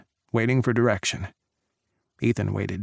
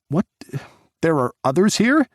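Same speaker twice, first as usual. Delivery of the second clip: higher and louder disbelief; shocked question; 6 semitones higher, 4 dB louder than usual. Acted. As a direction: blurt it out stunned. Jack shocked by agents.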